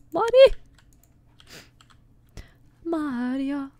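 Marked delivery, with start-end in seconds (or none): 0.1-0.6 calling out. Real, not acted. Character runs off, calls after.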